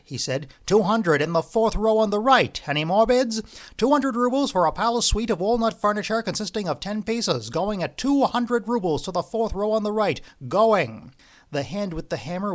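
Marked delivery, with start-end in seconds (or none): 0.7-11.1 higher, louder auctioneer patter; auctioneer calling. A narrator's reading. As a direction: call the bids to the room, raised.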